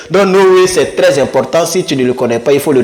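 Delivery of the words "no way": ordinary talk, nothing marked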